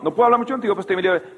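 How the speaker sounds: "no puedo hablar mucho contigo" gabbled very fast; rattled off fast. Shown as a demonstration. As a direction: rush it like brushing someone off. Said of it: "Así le dije".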